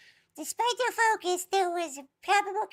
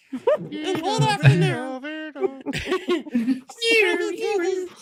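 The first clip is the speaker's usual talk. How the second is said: laughing while reading; 7 dB louder, much slower than usual. Real, not acted. Narrator breaks up laughing.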